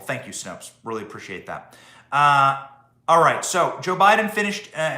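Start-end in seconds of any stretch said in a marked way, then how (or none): 0.0-1.7 dry, quiet sarcastic thanks. Acted. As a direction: mutter the thanks with mock gratitude.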